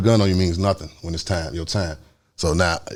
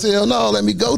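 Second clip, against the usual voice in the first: high-pitched plea; retold pleading; 12 semitones higher than usual. Passenger asking abductors to free him, high retold plea. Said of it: "I'm still trying to plead".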